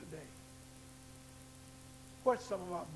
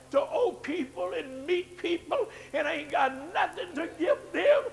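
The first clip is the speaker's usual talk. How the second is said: climbing louder and higher, slowing; building preaching; 6 semitones higher, 12 dB louder than usual. Acted. Pastor describing the shame of debt, in a building sermon cry. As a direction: swell it toward a shout.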